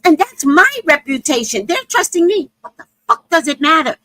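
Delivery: high, loud, defensive rant; indignant woman. Retold or imitated. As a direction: mimic her huffy self-justification. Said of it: "She goes".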